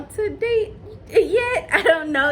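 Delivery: slow, high, half-crying whine; whiny mock cry. Real, not acted. Reviewing Diamond's date, fake-crying in exasperation. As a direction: whimper it in exasperated mock despair. Upset over Diamond's dating.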